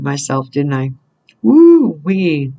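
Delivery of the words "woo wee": whooped woo-wee. Real, not acted. Reader joking about dating herself, swooping whoop.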